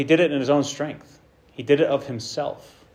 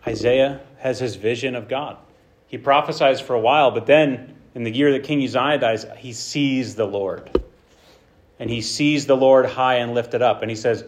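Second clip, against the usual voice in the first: usual speaking voice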